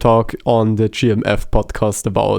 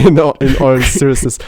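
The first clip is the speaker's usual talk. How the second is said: laughing; 2 semitones higher, 5 dB louder, faster than usual. Real, not acted. Laughing, trying to get serious.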